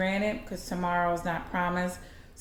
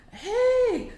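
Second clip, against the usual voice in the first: high, loud held call; 5 dB louder than usual. Retold or imitated. Old lady calling after a little boy, high-pitched hail.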